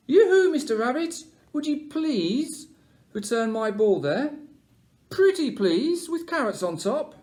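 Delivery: high, loud sing-song plea; sweet sing-song caller. Acted. Sweet caller asks for ball.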